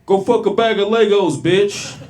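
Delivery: loud, high comeback shout; full-volume retaliatory yell; panicked yell. Retold or imitated. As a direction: blurt a shouted insult at another driver. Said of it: "I yell out".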